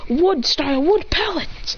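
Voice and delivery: lightly hammed-up jutsu announcement; attack call. Character calls out his attack.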